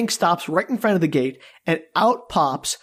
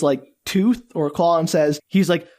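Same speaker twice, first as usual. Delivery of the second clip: usual speaking voice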